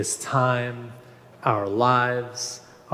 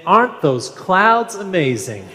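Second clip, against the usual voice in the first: put-on failed Texas accent; 5 semitones higher than usual. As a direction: try a Texas accent and miss it. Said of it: "I try to say that with my best Texas accent, but I fail at it".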